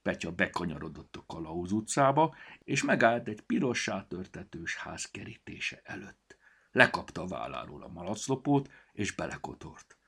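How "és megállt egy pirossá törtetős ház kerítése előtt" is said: usual speaking voice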